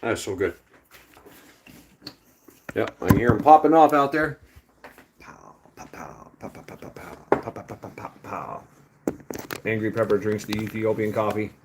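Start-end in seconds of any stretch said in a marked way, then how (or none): 5.2-8.6 soft breathy fireworks pops; whispered pow pow. Retold or imitated. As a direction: mouth the pops under your breath. Imitating fireworks popping outside.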